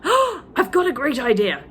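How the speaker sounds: a gasp, then eager and loud; excited cry. Acted. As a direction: blurt out the great idea. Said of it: "he cried".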